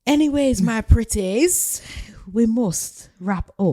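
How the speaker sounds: slow, loud cackling-witch voice calling my pretties; hammed-up wicked witch winding down; witchy sign-off. Host teasing listeners as a mock witch, loud and slow.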